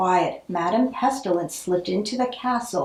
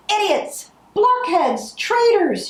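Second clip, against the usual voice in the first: high, louder furious screams; screaming insults; 12 semitones higher, 3 dB louder, slower than usual. Acted. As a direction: hurl each insult like a curse. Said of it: "she screamed".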